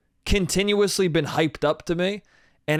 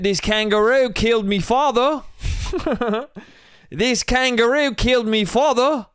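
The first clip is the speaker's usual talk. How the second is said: higher, hammed-up Australian vengeance speech; bad Australian accent; 5 semitones higher than usual. Host jokes as Australian Walton Goggins in a bad Australian accent. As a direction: ham up a cheap Australian movie voice. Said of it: "I'll stop doing that".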